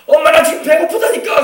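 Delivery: high, loud demanding whine to mom; insistent child shout. Retold or imitated. Preacher as a child shouting at his mother that he is hungry, high and loud. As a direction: shout it like a fed-up hungry kid.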